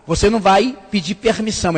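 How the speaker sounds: ordinary talk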